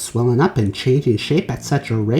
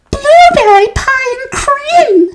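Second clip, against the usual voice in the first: put-on high, slow, loud girl's voice; squealing little girl; girl's shout; 9 dB louder, slower than usual. A narrator's reading. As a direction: shout it like a gleeful child. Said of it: "shouted Violet".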